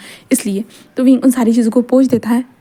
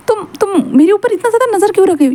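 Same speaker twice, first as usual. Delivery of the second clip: flustered dad; 9 semitones higher than usual. Acted. Wing flustered at his son.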